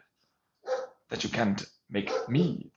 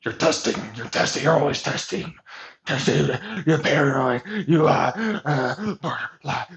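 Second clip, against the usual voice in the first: mock accusing voice; 3 semitones higher, 4 dB louder than usual. Mocking the people who label her paranoid, louder. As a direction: throw the accusations back as a mockery.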